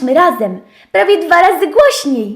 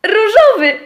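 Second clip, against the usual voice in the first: rozbawiona puenta; 8 semitones higher, 6 dB louder than usual. A narrator's reading. Puenta historii.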